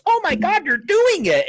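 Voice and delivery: high, loud imitation of an excited exclamation; retold high-pitched outburst; amazed outcry. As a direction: squeal it in mock astonishment. Acted excited quote of Shelly.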